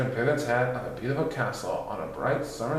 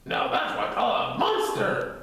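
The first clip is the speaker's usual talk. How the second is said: high airy hoot; breathy high gleeful voice; hooting delight; 13 semitones higher than usual. Acted. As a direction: crow with delight. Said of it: "hooted Titter".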